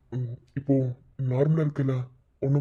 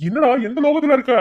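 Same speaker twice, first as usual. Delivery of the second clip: higher and louder, fed up; exasperated shout; 9 semitones higher, 6 dB louder than usual. Acted. Friend shouting at Saran lost in thought, exasperated. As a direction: snap at the daydreamer.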